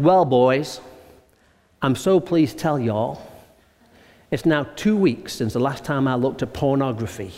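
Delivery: lightly voiced Texan man confessing; Texan accent. Pastor retelling Bob's confession in a mild Texan accent. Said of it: "in a very strong Texan accent".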